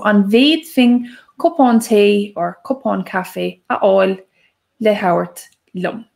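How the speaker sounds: slowly and clearly, each word set apart; careful slow second take. Shown as a demonstration. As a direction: say it again slowly for learners. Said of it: "I'll say it again".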